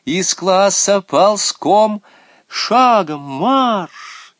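high, loud, stretched announcement; commanding high yell; headmistress's shouted command. A narrator's reading. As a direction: call it out as an order. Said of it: "закричала она".

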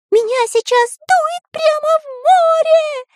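very high squealing cartoon cry; shouting. A narrator's reading. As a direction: shriek against the wind. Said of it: "кричал Карандаш".

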